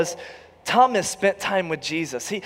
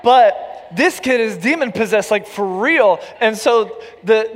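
higher, louder preaching voice; full-force preaching; 6 semitones higher, 5 dB louder than usual. Acted.

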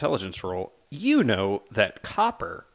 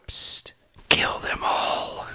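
tiny whispered otter voice; slower than usual. Acted. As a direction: whisper it like a sly little otter. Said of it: "a little otter voice go".

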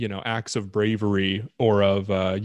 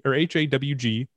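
ordinary delivery

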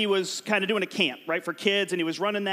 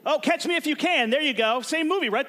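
excited host; 7 semitones higher than usual. Real, not acted. Game host reacting to answer.

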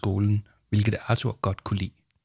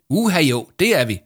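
bright, eager and louder; full of energy; 6 semitones higher, 5 dB louder than usual. A narrator's reading. Victoria insisting eagerly to Yama, full of energy. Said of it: "sagde Victoria fuld af energi".

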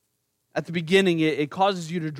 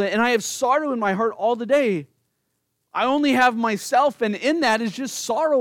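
higher, louder sorrowful pleading; raised voice of a lonely, grieving king; anguished lament; 5 semitones higher, 3 dB louder than usual. Acted. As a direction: pour out abandonment to God. David's lament voiced at length.

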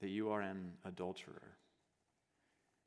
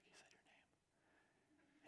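hushed, breathy church whisper; 30 dB quieter, much slower than usual. Retold or imitated. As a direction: whisper it so the preacher can't hear.

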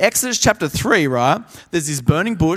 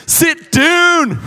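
loud high clipped order; stern command; 11 semitones higher, 5 dB louder than usual. Retold or imitated. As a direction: dismiss him like a strict teacher. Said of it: "And she says".

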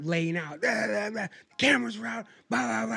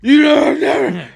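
high, loud laughing fit; laughing hard; 9 semitones higher, 13 dB louder than usual. Real, not acted. Telling the friends, breaking into laughter. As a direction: crack up mid-sentence. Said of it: "laughing breakdown".

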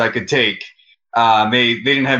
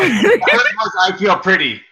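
laughing; 7 semitones higher, much slower than usual. Real, not acted. Teasing the movie title.

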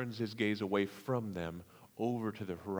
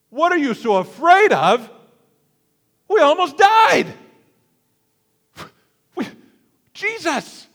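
much higher, much louder, exasperated yelling; frustrated outburst; 16 dB louder than usual. Retold or imitated. The preacher imagines snapping back at Jesus, loud exasperated shouting. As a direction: blurt it out in arrogant frustration. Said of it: "in my arrogance and frustration".

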